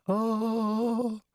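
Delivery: high, held heavenly "oh"; high celestial swoon, put on; mock angelic choir. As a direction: sing out like a cherub over harps. Heavenly harps and cherubs imagined.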